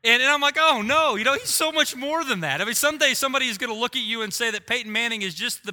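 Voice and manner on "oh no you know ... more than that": mock dismay. Retold protest to his son.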